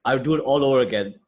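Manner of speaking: hammed-up conviction, arguing the point; emphatic quote. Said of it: "what do you think that means".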